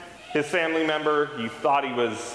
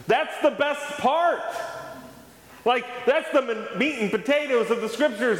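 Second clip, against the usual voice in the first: excited; 10 semitones higher than usual. Real, not acted. A pastor telling his congregation the good news, much higher and thrilled. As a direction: burst out with joy at the best part. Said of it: "I was a little flabbergasted".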